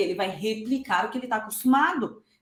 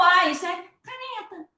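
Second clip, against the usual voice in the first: put-on baby's aha; 7 semitones higher than usual. Baby's realization voiced.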